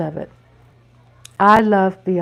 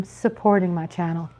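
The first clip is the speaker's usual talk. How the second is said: ordinary delivery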